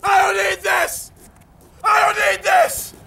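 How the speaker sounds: furious repeated shout; raging diva quitting; storming off. Acted. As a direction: yell it in stretched, fed-up bursts. Storms off screaming.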